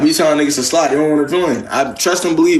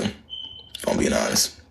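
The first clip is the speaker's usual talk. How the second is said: whispered aside; 13 semitones higher, 10 dB quieter, much slower than usual. Real, not acted.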